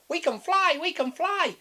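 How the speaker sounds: high, squawky macaw crying out; high excited macaw. Acted. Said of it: "said a macaw".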